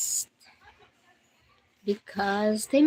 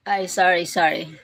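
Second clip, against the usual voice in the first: ordinary talk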